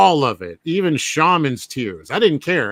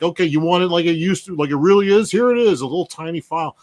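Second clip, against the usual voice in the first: mocking jerk; 5 semitones higher, faster than usual. Fan mimics filmmaker grudgingly offering the original cut, mocking jerk voice. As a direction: imitate a jerk grudgingly handing it over. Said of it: "like a jerk, he's like".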